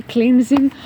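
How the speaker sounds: ordinary talk, nothing marked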